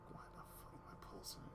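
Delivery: quick, voiceless whisper; whispered bafflement. Retold or imitated. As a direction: ask yourself under your breath. Retold puzzled thought.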